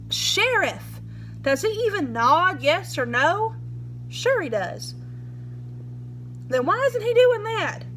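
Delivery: a hint of a hard-nosed lawman grilling the boy; pushy sheriff.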